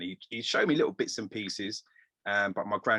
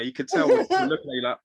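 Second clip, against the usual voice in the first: ordinary talk, nothing marked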